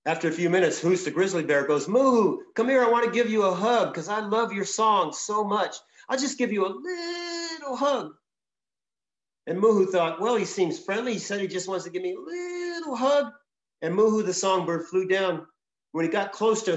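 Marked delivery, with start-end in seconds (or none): 1.9-8.2 high, coaxing bear voice wheedling a hug; a big bear sweetly luring the bird; sweet-talking bear. Acted. Storyteller voices the grizzly luring the songbird, high and coaxing. 10.2-13.3 a hint of a trusting little bird, higher; lightly voiced songbird. Songbird convincing itself the bear is friendly, lightly higher.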